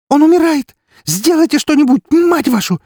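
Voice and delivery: срывающийся вопль, очень высоко и громко; кричи в истерике врачам; истерический крик. Acted. Ксения бьётся в истерике. (Ксения в истерике кричит на врачей)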